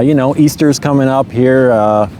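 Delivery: ordinary delivery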